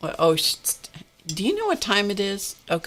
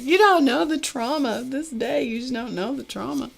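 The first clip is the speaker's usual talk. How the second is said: mock dramatic; 7 semitones higher than usual. Mock plea to the barista.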